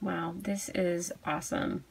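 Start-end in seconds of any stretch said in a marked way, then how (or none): none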